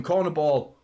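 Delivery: ordinary talk, nothing marked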